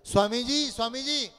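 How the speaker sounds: high, loud call up the mountain. Acted. Disciple Kalyan calls up to his guru Samarth, loud calling. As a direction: shout for the master from below. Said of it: "जोरात आवाज दिला".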